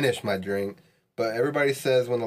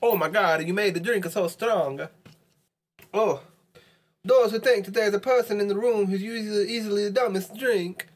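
high, sing-song mock Italian accent; 9 semitones higher than usual. Player protesting the rule to a friend in a mock Italian accent. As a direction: put on a hammy Italian accent, pitched well up. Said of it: "speak with an Italian accent".

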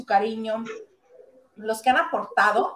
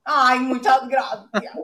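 sarcastic thanks; 4 dB louder, slower than usual. Pretend.

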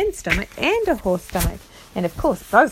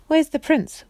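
higher-pitched storybook question; lightly voiced character asking, raised pitch; eager question; 8 semitones higher, 3 dB louder than usual. A narrator's reading. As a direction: pipe up to ask at once. Characters' urgent first question on waking.

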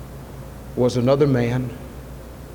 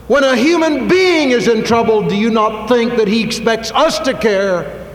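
much higher and louder, slow and emphatic; fervent preaching; 9 semitones higher, 4 dB louder than usual. Acted. Preacher urging the congregation to care, high, loud, fervent. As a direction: drive the point home from the pulpit.

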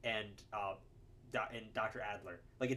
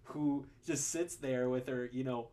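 normal talking voice

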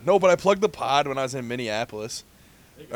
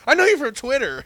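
excited fan; 11 semitones higher, 5 dB louder than usual. Retold or imitated. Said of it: "She's like".

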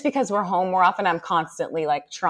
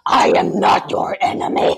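sweet demon voice; 4 dB louder than usual. Retold or imitated. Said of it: "full demon voice, but, like, as sweet as I could".

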